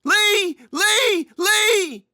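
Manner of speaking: high, loud faked panic shrieks; mock terrified scream. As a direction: scream as if grabbed by the killer. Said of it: "Lee screamed too".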